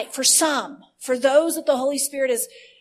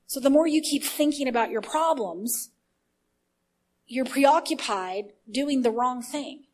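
normal talking voice

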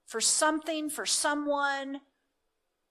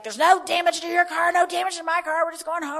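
imitating a louder, higher angry shout; angry woman screaming; 5 semitones higher, 8 dB louder than usual. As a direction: retell her angry yell, half-acted. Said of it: "but she's screaming at me".

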